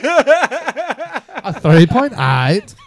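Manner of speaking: put-on slow drawn-out European accent, laughing; nondescript European accent. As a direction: stretch each word slowly in a vague European accent. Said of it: "That's why I'm doing a nondescript accent".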